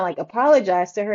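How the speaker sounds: ordinary delivery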